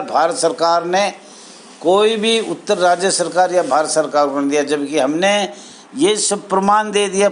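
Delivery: ordinary talk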